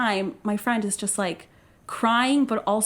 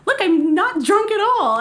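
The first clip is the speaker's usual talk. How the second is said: higher, louder forced casualness; mock casual sober; 10 semitones higher, 5 dB louder than usual. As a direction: insist you're fine too brightly. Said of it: "trying to be, like, super".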